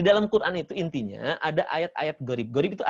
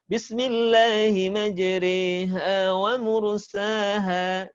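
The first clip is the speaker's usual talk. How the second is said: slow, higher chanted tilawah; Quran recitation; 4 semitones higher, much slower than usual. Shown as a demonstration. (lantunan tilawah pelan dan tinggi)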